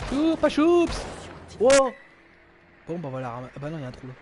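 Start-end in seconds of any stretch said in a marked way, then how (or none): 0.1-1.9 high loud startled squeal; excited yelp. Real, not acted. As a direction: yelp at the close call. Near miss in game.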